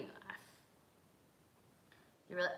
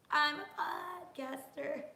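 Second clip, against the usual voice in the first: proud sing-song; 10 semitones higher, 3 dB louder, slower than usual. Real, not acted. Proud boast after celebration.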